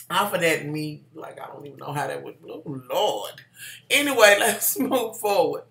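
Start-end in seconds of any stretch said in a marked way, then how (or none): none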